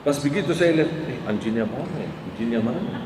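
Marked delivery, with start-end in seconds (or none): 1.1-3.0 low, soft puzzled murmur; feigned innocent searching. Retold or imitated. Preacher retelling his reply to an insult, softly feigned puzzlement. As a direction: softly wonder aloud, playing innocent. Re-enacts looking around for the dog.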